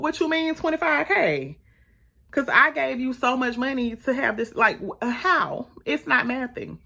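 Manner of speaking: high-pitched, disbelieving demand; mock-indignant high voice; incredulous questioning. Acting out disbelieving questioning about money.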